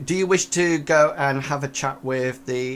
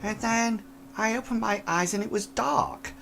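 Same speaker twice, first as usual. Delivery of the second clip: put-on higher, hushed old lady telling; soft and spooked, like a frail old woman; 6 semitones higher, 5 dB quieter than usual. Old lady recounts seeing figure.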